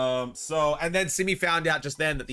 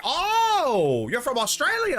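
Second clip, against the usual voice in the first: surprised; 10 semitones higher, 3 dB louder, much slower than usual. Retold or imitated. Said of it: "she's just like".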